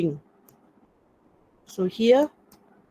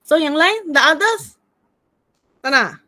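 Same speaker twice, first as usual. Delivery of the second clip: higher, louder call to the group; 6 semitones higher, 6 dB louder than usual. Real, not acted. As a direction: call out to everyone. Calling students for photo.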